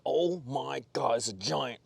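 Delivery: slow, drawn-out mock amazement; awestruck. Angler retelling his first sight of a giant, awestruck. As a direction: stretch out the awe, word by word. Retold awe at a huge fish.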